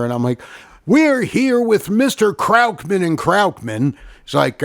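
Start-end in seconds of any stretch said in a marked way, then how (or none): 0.8-3.9 high, booming interviewer intro; big singsong announcer voice; hammed-up interviewer. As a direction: announce the guest like a cheesy TV host. Mock booth interview, retold.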